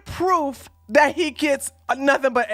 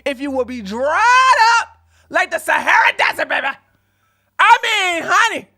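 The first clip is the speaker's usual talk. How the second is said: sassy mock drama; 5 semitones higher, 5 dB louder than usual. Real, not acted. Streamer sass, mock drama.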